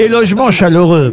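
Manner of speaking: brisk radio sponsor read; upbeat radio ad read over music. Acted. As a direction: sell the holiday club like a live ad. Ad read continues.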